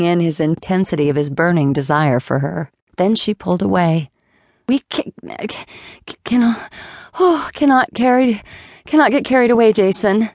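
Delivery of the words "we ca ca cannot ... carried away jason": high, halting stammer, catching on each word. Acted. Flora pulling away from Jason's kiss, flustered high stammer. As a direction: stumble over cannot, flustered and breathless. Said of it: "she stammered with some difficulty".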